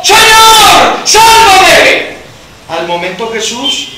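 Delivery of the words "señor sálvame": high, loud cry for rescue; grito de auxilio. Acted. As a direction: shout desperately for help while sinking. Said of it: "Entonces gritó". (grito agudo pidiendo auxilio)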